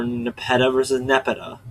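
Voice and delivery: Nepeta first as ne-PET-a, then Nepeta as NEP-eta; contrasting pronunciations. Shown as a demonstration. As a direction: shift the stress in Nepeta from second to first syllable. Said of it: "difference between pronunciations".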